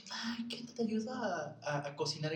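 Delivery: high, slow pleading offer to help; eager aunt. Retold or imitated. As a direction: drag it out sweetly like the eager aunt. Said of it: "Así como". (tía ofreciendo ayuda, aguda y muy lenta)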